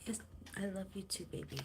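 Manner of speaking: soft murmur. Real, not acted. Murmuring to the cat.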